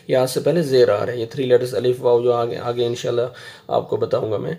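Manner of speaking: normal talking voice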